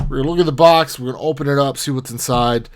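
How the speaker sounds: ordinary talk, nothing marked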